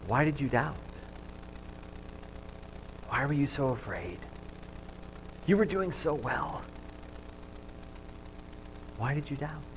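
softly spoken rebuke of Jesus; gentle and hushed, no yelling; quiet. Acted. Jesus to Peter in the sinking boat, soft and hushed. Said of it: "what Jesus says to him very quietly".

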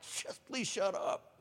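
annoyed grumble. Retold or imitated. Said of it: "that mad spirit's just starting to get aggravated".

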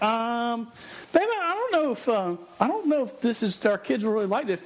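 a hesitant husband doubting the ride. Retold or imitated. To his wife at the ride, a high, doubtful hedge. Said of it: "I'm like".

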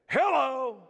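high, loud, syrupy pastoral hello; spiritually sensitive voice. Retold or imitated. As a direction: sound like the holiest pastor on the phone. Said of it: "in my most spiritually sensitive voice".

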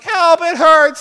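high, loud hammed-up child whine; whiny kid plea. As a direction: wail it like a hurt little kid. Kid begging dad for help.

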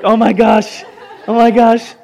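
hammed-up mortification; mock embarrassed student. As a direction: squeal it like an embarrassed student, half laughing. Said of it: "most embarrassing ever".

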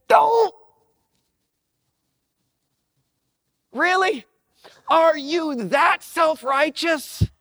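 high, incredulous disbelief; exasperated. Retold or imitated. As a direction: scoff at his self-righteousness. Preacher voices exasperated Jesus.

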